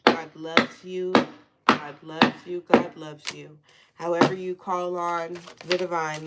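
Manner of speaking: normal talking voice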